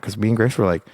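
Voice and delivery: normal talking voice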